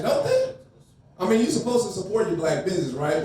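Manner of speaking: ordinary delivery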